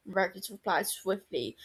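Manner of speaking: plain reading voice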